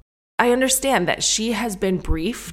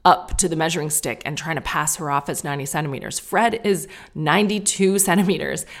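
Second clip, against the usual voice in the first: normal talking voice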